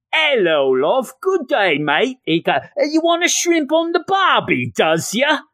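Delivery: hammed-up, high-pitched Australian accent. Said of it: "I sound Australian".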